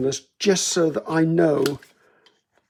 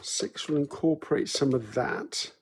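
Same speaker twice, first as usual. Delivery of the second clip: softer, under-breath working out; muttering to myself; 7 dB quieter than usual. Real, not acted. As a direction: mumble numbers to yourself. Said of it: "sorry, I'm muttering to myself".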